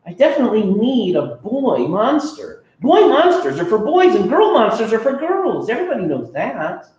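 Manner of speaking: put-on high kid voice, sure of himself; a high kid voice stating the obvious; insistent kid. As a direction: lay down the rule like a know-it-all.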